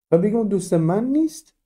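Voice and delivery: نیست lifted high with a rising end; stress on نیست. Shown as a demonstration. Teacher stressing the negative for the class. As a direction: lean on نیست.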